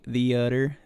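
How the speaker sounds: the utter, theater said as "the utter" with a dropped th; his own way of saying it. Shown as a demonstration. Showing a friend how he says theater, as "the utter". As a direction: run "the" into "utter", the utter. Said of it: "the utter is how I say it".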